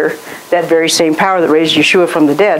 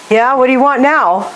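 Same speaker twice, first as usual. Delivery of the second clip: weary Moses; 6 semitones higher than usual. Retold or imitated. Voicing Moses, annoyed.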